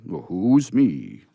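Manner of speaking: slow, loud, booming Santa; gruff Santa shout. Acted. Santa demanding who is there, booming. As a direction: boom it like Santa. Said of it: "shouted Santa Claus".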